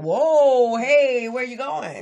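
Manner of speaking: loud, high "whoa, hey" from a startled father; dad calling out. Retold or imitated. Father stopping daughter at the door, loud call. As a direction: call out to stop her leaving. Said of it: "my daddy was like".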